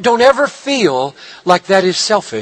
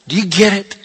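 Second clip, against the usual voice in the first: ordinary talk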